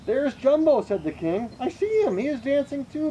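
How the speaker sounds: high, bright excited call. Acted. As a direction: cry out in joy at finding him. King spots his lost elephant.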